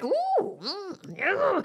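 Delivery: high delighted ooh; awed reaction. Retold or imitated. Hearing LaShawn sing, a high delighted swoon. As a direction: swoon at a great singer. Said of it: "you be like".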